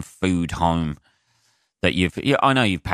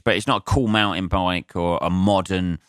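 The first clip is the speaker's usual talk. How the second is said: usual speaking voice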